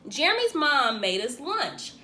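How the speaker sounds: ordinary delivery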